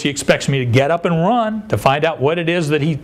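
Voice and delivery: normal talking voice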